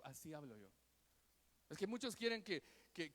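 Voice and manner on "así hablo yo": soft voice. Retold or imitated. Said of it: "Dios habla suavemente".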